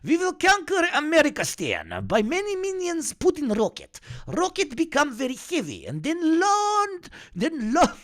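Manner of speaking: mock villain monologue in a cartoon accent; Gru impression. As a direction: scheme grandly as the cartoon villain. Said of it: "Gru talking".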